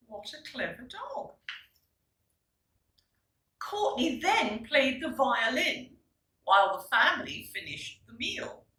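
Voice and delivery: plain reading voice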